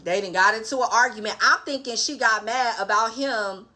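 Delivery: normal talking voice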